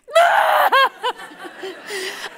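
sudden loud high wail; mock bawling. Mom driving behind her son's truck, hammed-up wailing sob. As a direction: burst into hammed-up sobs. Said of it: "I burst into tears".

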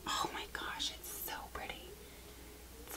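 hushed with delight at the shade; awed whisper. Real, not acted. Trying on a new lipstick, an awed whisper to viewers. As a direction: breathe it out, barely voiced.

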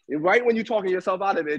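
ordinary talk, nothing marked

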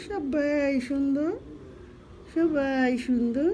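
slow, drawn-out doting praise. Real, not acted. Slowly praising all her baby pets. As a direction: stretch each word lovingly.

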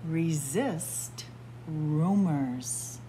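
usual speaking voice

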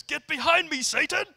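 Jesus rebuking Peter at full shout; commanding shout. Retold or imitated. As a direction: bellow it to drive the devil off. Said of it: "Jesus goes".

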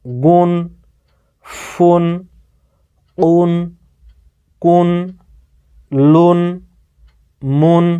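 each syllable ending in উন said alone, clear and separated by pauses; pronunciation demo. Shown as a demonstration. Teacher showing students the double-damma sounds, slow isolated syllables. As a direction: model every sound crisply for students to copy. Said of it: "এবারে উচ্চারণগুলো দেখেন".

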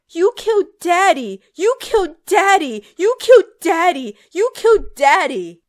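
loud, high, repeated screaming accusation; raging sister screaming down at Emily in the grave; furious shrieking. Acted. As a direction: hammer each line like a blow. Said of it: "Nancy shrieked".

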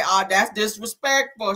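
normal talking voice

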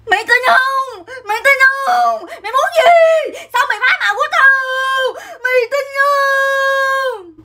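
high-pitched hysterical threatening scream, stretched endings; gào dọa nạt. Acted. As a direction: scream it as an over-the-top threat, dragging the last word. Kể lúc cầm dao dọa em. (gào thét dọa em, giọng cao vút, kéo dài chữ cuối)

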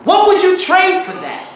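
building, high-pitched exhortation; impassioned challenge. Real, not acted. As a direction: press the congregation with pointed questions. Preacher challenging the congregation.

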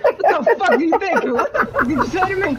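hammed-up high indignant rant through laughter; fake-furious, half laughing; mock outrage. Imagined reply to wife's comeback, hammed-up indignant rant.

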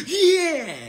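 high, falling mock cheer; mocking losers. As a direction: mimic losers with a high "yeah". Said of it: "losers that be beside each other".

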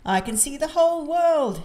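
high, loud excited exclamation; thrilled. A narrator's reading. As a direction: call out in wonder from up high. Peppa thrilled high in plane.

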